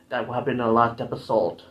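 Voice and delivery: ordinary delivery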